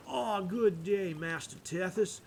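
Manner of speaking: slow Georgia priest greeting; deep Georgian accent. Acted. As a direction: greet with a stretched Georgia drawl. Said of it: "the deep Georgian accent".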